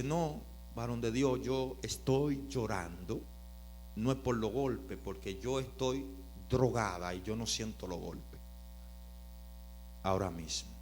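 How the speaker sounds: a woman in the car explains her tears quietly; tearful woman. Retold or imitated. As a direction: let her words come through emotion. Woman voiced crying in story.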